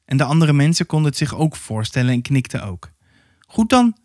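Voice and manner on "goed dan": high-pitched woman character; woman's voice. A narrator's reading. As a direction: concede in a lifted woman's voice. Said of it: "zei een vrouw".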